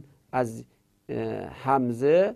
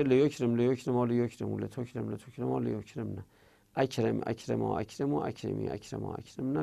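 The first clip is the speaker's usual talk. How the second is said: quick running recitation, getting softer as each series goes on; fast drill, voice sinking away through the list; rapid conjugation; 4 dB quieter than usual. Real, not acted. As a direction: rattle off the verb table by rote.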